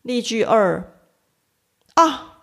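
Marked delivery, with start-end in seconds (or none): 1.9-2.4 sharp high “啊”, then fast complaint; a sudden light yelp of pain, then rush the rest; 假装被踩到脚，一声“啊”; mock pain. Shown as a demonstration. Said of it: "痛苦".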